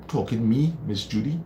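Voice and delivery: ordinary delivery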